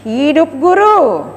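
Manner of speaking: loud slow rally cry; rally speech. Real, not acted. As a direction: call it out to rouse the crowd. Closing rally chant to crowd.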